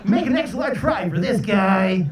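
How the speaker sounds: DJ patter at a drive-thru; radio DJ voice. Acted. DJ ordering lunch at the drive-thru, hyped radio voice. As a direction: order food like you're on air. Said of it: "They really talk like that".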